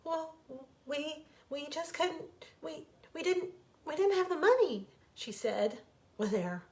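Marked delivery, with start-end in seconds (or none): 0.0-4.9 halting, higher, anxious stammer; nervous stammer. Acted. Wife confessing money troubles to husband, anxious stammer. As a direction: stumble through the confession.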